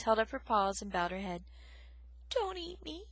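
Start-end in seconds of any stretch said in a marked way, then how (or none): 2.3-3.1 high, soft frightened plea; a mouse pleading on her knees; imploring. Acted. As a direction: beg softly for mercy. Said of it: "she implored".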